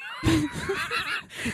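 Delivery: high-pitched burst of laughter; high shrieking laugh. Real, not acted. Big laugh after teasing Emily.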